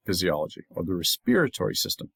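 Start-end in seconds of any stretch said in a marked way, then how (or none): 0.9-1.7 respiratory as res-PEER-a-tory; Canadian-style "respiratory", second syllable stressed; Canadian pronunciation. Shown as a demonstration. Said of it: "the same thing in Canada".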